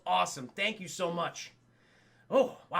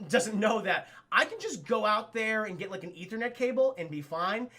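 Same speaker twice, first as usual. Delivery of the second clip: ordinary delivery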